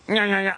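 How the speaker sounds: high repeated munching noises; mimed chewing. Retold or imitated. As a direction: imitate chewing another one with quick nyahs. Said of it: "chew it like gum".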